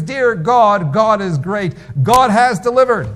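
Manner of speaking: rising, elated call to heaven; joyful cry to God. Retold or imitated. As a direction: cry it out in delight at the groceries. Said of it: "he cries out".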